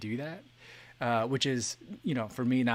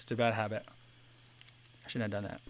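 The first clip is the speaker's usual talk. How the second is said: ordinary talk, nothing marked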